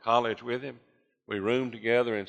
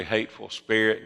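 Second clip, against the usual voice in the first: usual speaking voice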